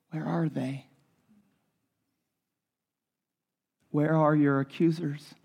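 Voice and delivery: hushed and low, gently asking; most tender voice. Retold or imitated. Jesus to the shamed woman, asking tenderly. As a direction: ask her as if lifting her chin. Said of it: "the most tender voice".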